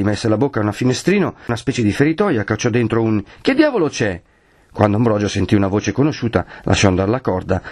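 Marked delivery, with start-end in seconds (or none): 3.4-4.2 high-pitched call into the belfry. Acted. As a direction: shout up to someone inside. Shouts into the belfry window. (richiamo gridato, acuto)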